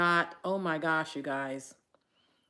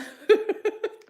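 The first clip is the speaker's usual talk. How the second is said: high breathless tearful laugh; 9 dB louder than usual. Real, not acted. To live viewers, after the funeral talk, a high tearful laugh. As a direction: laugh through the tears. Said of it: "I'm not gonna be able to stop crying".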